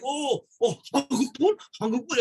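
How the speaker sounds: high, excited elder asking; 놀라며 반가운 말투; surprised Korean patient. Retold or imitated. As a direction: imitate a delighted Korean patient. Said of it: "they're like".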